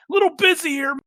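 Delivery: high, loud hurried reply; loud brush-off. Retold or imitated. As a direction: cut her off loudly. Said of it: "It's totally your mom calling".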